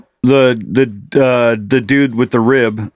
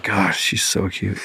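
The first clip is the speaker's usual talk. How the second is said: quiet, slow, lovestruck; soft dreamy quote; 7 semitones lower, 9 dB quieter, slower than usual. Retold or imitated. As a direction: sigh it out softly. Retold crush, soft quote.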